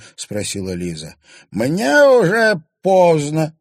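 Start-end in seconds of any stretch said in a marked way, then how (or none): 1.5-3.6 high, slow, loud bitter crone; bitter old crone. A narrator's reading. Old woman answers the girl with a bitter smirk. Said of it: "горько усмехнулась старуха".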